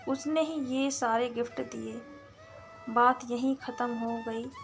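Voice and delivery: plain reading voice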